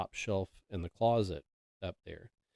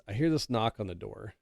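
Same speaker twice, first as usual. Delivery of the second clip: ordinary delivery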